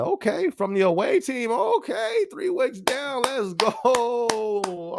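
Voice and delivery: high-pitched cheer, stretched at the end; excited hype. Real, not acted. Streamer cheers on viewer joining the away team, excited hype. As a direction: hype up a teammate from afar.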